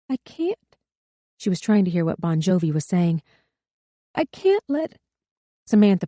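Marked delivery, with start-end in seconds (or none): 0.1-0.6 much higher, soft, breaking; grieving girl unable to stand; tearful refusal. A narrator's reading. 4.1-5.0 put-on grieving girl unable to stand; tearful refusal. A narrator's reading.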